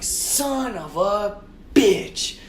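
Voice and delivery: exasperated cursing. Retold or imitated. Driver curses after scraping the car, long retold groaning curse. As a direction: stretch every word in mock agony.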